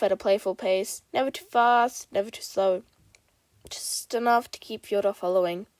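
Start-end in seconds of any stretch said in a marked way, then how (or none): none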